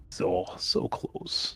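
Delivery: drawn-out, soft whisper; hushed near-miss. Real, not acted. Player reacting to a narrowly missed roll, hushed and slow. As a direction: whisper it slowly after just falling short.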